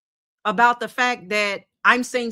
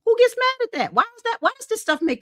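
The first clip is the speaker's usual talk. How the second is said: incredulous; 13 semitones higher than usual. Real, not acted. Incredulous rant about angry people.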